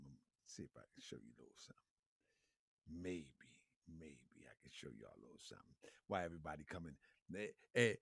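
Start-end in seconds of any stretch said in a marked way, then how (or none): none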